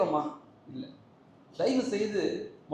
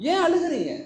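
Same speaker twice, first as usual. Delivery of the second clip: ஏன் pushed higher and louder; urgent repeat; 8 semitones higher, 3 dB louder than usual. Retold or imitated. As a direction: ask ஏன் again, pressing.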